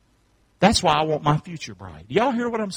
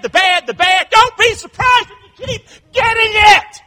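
very high, loud pulpit shout; impassioned preacher shouting at full pitch; full-force preaching; 10 dB louder than usual. Real, not acted. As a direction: cry it out to the congregation, climbing higher.

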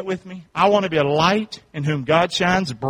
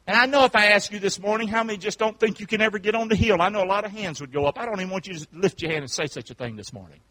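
usual speaking voice

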